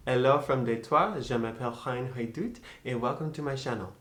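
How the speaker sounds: French-accented greeting; cheery French-accented intro. Shown as a demonstration.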